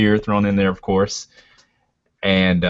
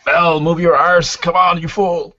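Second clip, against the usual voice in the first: high, loud shout to a straggler; raised, impatient holler; urgent yell; 10 semitones higher, 5 dB louder than usual. Acted. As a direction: yell at a dawdling companion to get moving. Player urges a slower companion on.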